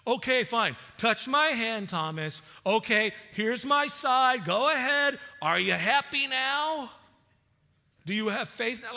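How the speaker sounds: sarcastic indignant rant, hammed up; mock exasperated Jesus. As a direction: ham up a fed-up, impatient Jesus. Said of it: "that's not at all the tone".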